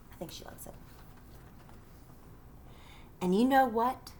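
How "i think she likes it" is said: whispered aside. Real, not acted. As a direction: whisper it so she won't hear. Aside about the listening child.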